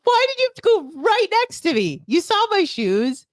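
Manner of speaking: ordinary talk, nothing marked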